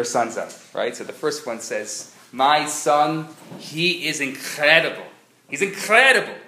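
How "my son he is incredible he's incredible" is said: a hint of a kvelling mother; bragging mother. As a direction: boast like a doting mother. First mother bragging about her son.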